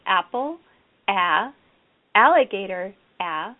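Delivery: "Apple", "alligator", each followed by an isolated short-a "ah", as in ant; first-sound modelling. Shown as a demonstration. Teacher models first sounds to class, "Apple", pause, short-a "ah", "alligator", pause, "ah". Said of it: "the teacher would say".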